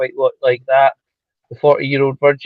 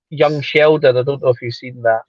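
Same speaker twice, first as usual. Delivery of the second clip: usual speaking voice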